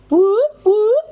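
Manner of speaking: very high, short disco whoops; high-pitched warm-up whoop. Shown as a demonstration. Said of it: "let's do a disco".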